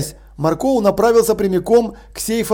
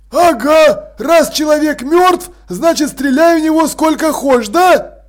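higher, louder sarcastic snap; snapped back; 8 semitones higher, 4 dB louder, slower than usual. A narrator's reading. Zombie constable snapping at the robbers who shot him. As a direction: bite back at the robbers, stung. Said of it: "огрызнулся констебль Башмак".

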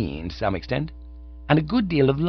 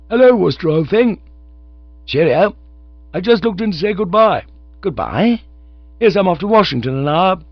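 put-on high, loud chirpy young chap; cheerful; 10 semitones higher, 8 dB louder than usual. A narrator's reading. Cyril dropping in to say goodbye, high and cheerful. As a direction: breeze in cheerfully at high pitch. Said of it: "quite fairly cheerful".

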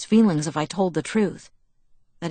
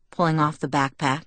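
ordinary delivery

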